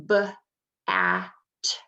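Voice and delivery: b, a, t segmented sound by sound. Shown as a demonstration. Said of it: "I'm gonna make the sounds of bat".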